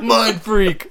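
high, loud, laughing catchphrase; mind freak catchphrase. Retold or imitated. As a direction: belt it out like a showman. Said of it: "He said".

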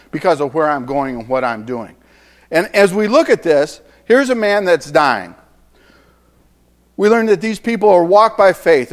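ordinary talk, nothing marked

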